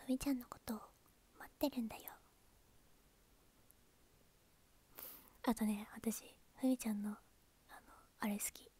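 soft whispered chat to viewers; whispered livestream talk. Real, not acted. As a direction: keep it hushed, close to the mic. Whispering streamer, ASMR-like.

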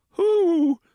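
high, loud startled "Who?"; frightened squeak. Acted. Moles caught in the dark, squeaking in fear. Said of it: "they quavered in fear and astonishment".

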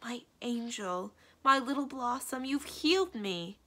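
high, slow, adoring exclamation; besotted cry. A narrator's reading. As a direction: gush over your healer with lovestruck wonder. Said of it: "cried Ahriman, growing more and more besotted".

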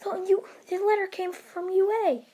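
high, loud, excited calling out; excited mom bursting in with news. Acted. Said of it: "his mom busts into his room saying".

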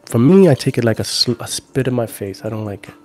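usual speaking voice

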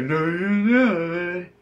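very high, loud, slow drawn-out character voice; high slow mock NPC refusing to leave; creepy NPC voice. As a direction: stretch it out like a clingy game bot. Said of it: "And then he's like".

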